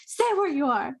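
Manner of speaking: high, tense goblin guard warning; wary goblin raising its voice at strangers. Acted. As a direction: hold them off at crossbow point. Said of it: "It's like".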